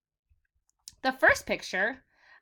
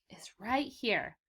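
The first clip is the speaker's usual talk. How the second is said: whisper; 3 semitones lower, 8 dB quieter than usual. Real, not acted. Teacher points out next word.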